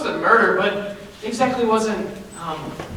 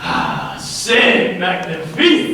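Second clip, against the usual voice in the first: slow, savoring fake French accent on c'est magnifique; fake French flourish; 2 semitones lower, much slower than usual. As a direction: relish it like a cartoon French chef. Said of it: "Stop that".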